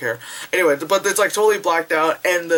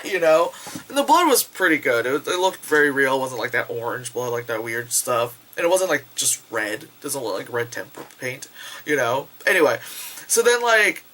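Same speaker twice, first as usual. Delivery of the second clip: ordinary delivery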